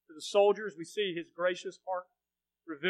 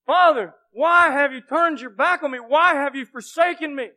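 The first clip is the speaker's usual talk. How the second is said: loud anguished cry; 7 semitones higher, 12 dB louder than usual. Acted. Preacher voicing Jesus crying out from the cross, loud. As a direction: cry out high and loud to the Father. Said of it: "as he says those things".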